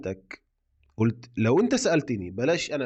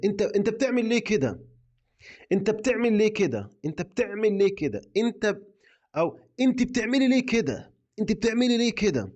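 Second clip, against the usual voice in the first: reproachful question; 9 semitones higher than usual. Shown as a demonstration. Teacher acts out a reproachful question.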